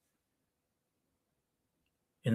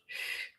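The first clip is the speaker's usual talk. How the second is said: soft unvoiced shh; hushing shh; 16 dB quieter than usual. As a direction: hush him before the door opens. Hushing him at the door.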